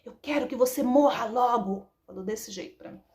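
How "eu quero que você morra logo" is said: imitating a son's angry outburst; mock anger, pushed out louder; angry child. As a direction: act out a furious kid, moderately. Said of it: "ele tava bravo, com raiva".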